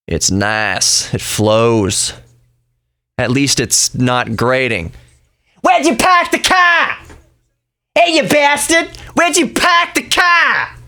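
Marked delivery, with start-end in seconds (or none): 5.6-10.8 very high, harsh Boston yell caricature; mock Boston accent. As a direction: yell it like a grating Bostonian, dropping the R's. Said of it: "There's a drawl to the Southern accent".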